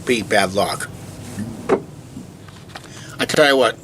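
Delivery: normal talking voice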